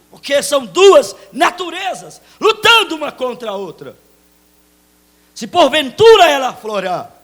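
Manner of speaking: rising from firm to full shouted preaching; building preaching. Real, not acted. Pastor preaching to the church, building to a shout. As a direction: build the sermon up to a roar.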